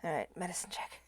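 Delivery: usual speaking voice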